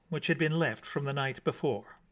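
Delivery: ordinary delivery, nothing marked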